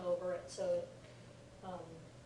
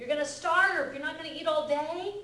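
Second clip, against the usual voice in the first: mock alarm; 6 semitones higher, 7 dB louder than usual. Mock worried parent scolding.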